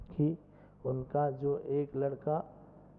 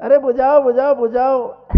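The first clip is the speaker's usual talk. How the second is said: calling out; 8 semitones higher, 14 dB louder than usual. Retold or imitated. Father shouting to villagers.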